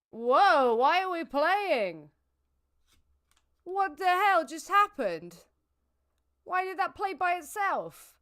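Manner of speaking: high, loud, slowed alarm at the screen; startled shout. Real, not acted. Streamer startled when the game plays itself, yelling high and loud. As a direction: caught off guard, yelling what just happened.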